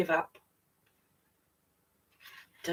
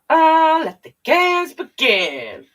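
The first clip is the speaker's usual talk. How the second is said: loud, higher, slow opening call; grand announcement; 10 semitones higher, 7 dB louder, slower than usual. Acted. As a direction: announce it like a ringmaster. Announcing the start of competition.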